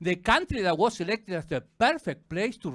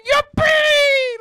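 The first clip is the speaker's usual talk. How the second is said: very high, loud joyful squeal; gleeful personified penis; 14 dB louder, much slower than usual. Acted. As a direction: squeal like an overjoyed little kid. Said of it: "you can hear my dick going like".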